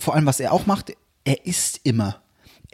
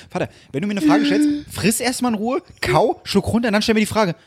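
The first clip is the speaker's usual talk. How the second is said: high-pitched exasperated son; exasperated scolding; 5 semitones higher than usual. Retold or imitated. Son scolding his father for eating on calls, retold exasperation. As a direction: tell Dad off, pitch raised.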